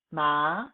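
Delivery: "ma" rising steadily to the top; second tone. Shown as a demonstration. Teacher models tones for class, second tone. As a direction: let "ma" climb like a question. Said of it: "four basic tones with example".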